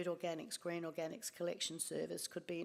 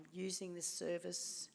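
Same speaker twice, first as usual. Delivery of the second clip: normal talking voice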